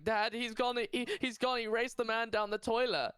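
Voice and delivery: put-on high, upset child's cry; child telling mum dad is gone, high and frantic; panicked child. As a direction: blurt it out in a panic. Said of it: "And I'm like".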